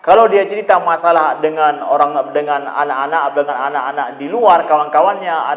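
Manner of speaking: ordinary delivery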